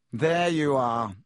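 slow, stretched-out drawl. A narrator's reading. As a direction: draw the words out lazily. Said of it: "he drawled".

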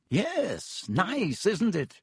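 slow, high preening drawl; Lockhart voice. Acted. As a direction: savour the title vainly.

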